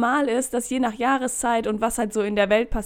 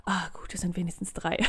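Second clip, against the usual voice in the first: whisper; 5 semitones lower, 9 dB quieter than usual. Retold or imitated. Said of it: "denke ich".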